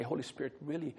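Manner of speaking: softly voiced private prayer; not out loud. Counselor asks the Holy Spirit for help with a client, softly. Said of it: "I don't have to say it out loud".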